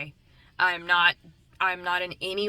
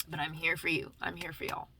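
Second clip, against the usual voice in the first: usual speaking voice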